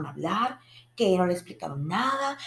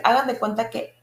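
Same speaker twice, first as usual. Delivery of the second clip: normal talking voice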